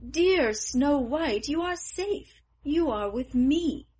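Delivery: high, loud and slow with joy; overjoyed, lean into every word; joyful. A narrator's reading. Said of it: "Full of joy".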